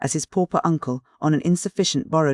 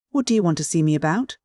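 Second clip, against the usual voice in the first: ordinary delivery, nothing marked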